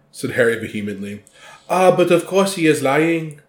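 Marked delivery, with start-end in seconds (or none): 1.7-3.4 Madame Maxime voice. Acted. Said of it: "I like it. Good job".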